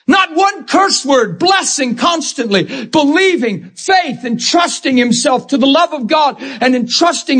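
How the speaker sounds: high, impassioned preacher's cry; fervent sermon delivery; full-force preaching. Acted. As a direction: preach it out with fire.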